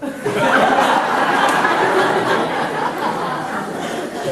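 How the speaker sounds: sustained open laughter; long laughter. Real, not acted. The speaker laughing at the mistaken contact. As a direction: let the laugh roll on.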